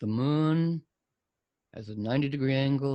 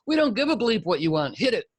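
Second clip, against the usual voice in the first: hint of a fierce old teacher barking; 6 semitones higher, 4 dB louder, faster than usual. Astrologer imitating her fierce old teacher, mock stern bark. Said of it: "she'd go".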